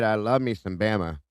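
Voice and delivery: usual speaking voice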